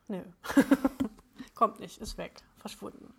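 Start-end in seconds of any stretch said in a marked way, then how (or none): 1.6-3.1 trailing off. Real, not acted. Giving up on the lost thought.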